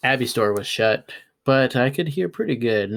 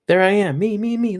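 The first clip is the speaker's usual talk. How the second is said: high, thrilled squeal of recognition; excited child; 10 semitones higher, 4 dB louder than usual. Acted. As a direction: point at the screen, beside yourself. Child sees herself on TV.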